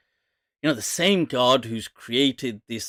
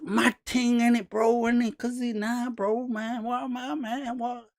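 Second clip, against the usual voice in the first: high, soft mock Mandem drone; imitated Mandem slang; 8 semitones higher, 6 dB quieter than usual. Imitating how these guys all speak, mock Mandem accent. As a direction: mimic Mandem street talk, fading softer. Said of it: "do these guys all just speak like that".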